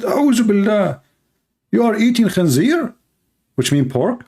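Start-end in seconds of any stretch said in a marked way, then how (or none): 0.0-2.9 high, shocked outcry as the friend. Retold or imitated. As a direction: play the horrified friend, pitched up. Friend shocked at eating pork.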